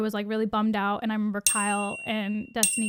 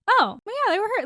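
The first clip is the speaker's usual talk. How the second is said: much higher, mock-defensive quote; high-pitched retort; 9 semitones higher, faster than usual. Answering him about hurt feelings, high-pitched retort. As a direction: pitch it up, retelling the comeback.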